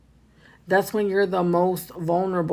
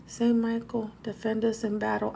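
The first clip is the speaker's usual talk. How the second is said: hushed prayer; 3 semitones higher, 7 dB quieter than usual. Retold or imitated. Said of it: "trying to say the Saint Michael prayer".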